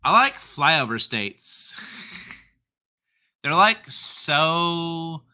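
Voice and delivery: put-on slow, drawn-out Valley girl voice. Host replaying the comment he instinctively read that way, Valley girl voice. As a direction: stretch the vowels like a Valley girl. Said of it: "read that like a Valley girl".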